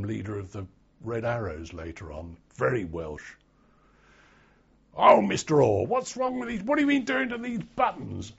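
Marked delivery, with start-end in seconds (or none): 5.0-8.3 high, loud teasing sergeant; mock drill sergeant. Veteran imitating flight sergeant questioning a recruit, high and loud. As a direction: ham up the sergeant's mock concern.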